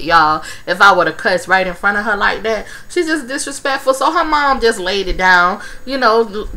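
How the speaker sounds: normal talking voice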